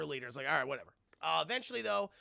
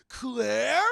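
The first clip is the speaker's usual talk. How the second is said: loud, rising question; hammed-up stunned query; astonished recognition; 3 semitones higher, 8 dB louder than usual. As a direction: call the name in mock disbelief. Acts Chris spotting his sister.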